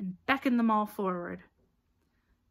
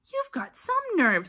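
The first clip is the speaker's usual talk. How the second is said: high, loud outrage; loud, affronted protest; 10 semitones higher, 7 dB louder than usual. A narrator's reading. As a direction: exclaim it indignantly. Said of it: "said Ron loudly".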